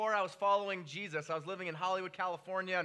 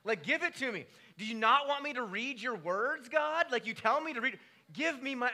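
louder, higher demanding plea; mock angry prayer; 5 semitones higher, 4 dB louder than usual. Preacher retelling his prayer to God, hammed-up indignation. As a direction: insist God give it back, exasperated. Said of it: "I said this to God".

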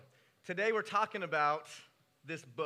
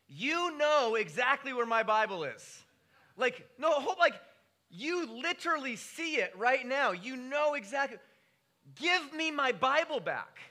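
raised, demanding plea to God; mock angry prayer; 6 semitones higher, 5 dB louder than usual. Preacher pleading with God for his lost Bible, mock outrage. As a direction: demand the Bible back from God, half joking.

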